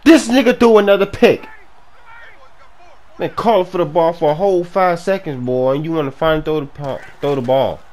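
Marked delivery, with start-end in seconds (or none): 0.0-1.5 loud, high-pitched outburst; outraged shout. Real, not acted. Gamer fuming over another interception, outraged shout. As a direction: yell in real exasperation.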